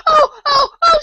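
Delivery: very high, loud shrieked cries of "oh"; loud screams. A narrator's reading. The Queen screaming before she is hurt, shrieking. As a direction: scream each cry out like a whistle. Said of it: "the Queen began screaming so loud".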